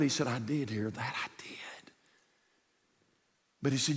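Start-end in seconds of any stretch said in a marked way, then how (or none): 0.3-1.9 soft hushed whisper. Acted. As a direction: breathe it like a secret. Jesus answers quietly.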